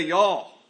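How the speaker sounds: "y'all." said as a drawn-out Texan yaw. Shown as a demonstration. Preacher showing how Texans say "y'all." in a Texan accent. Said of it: "in Texan, we say".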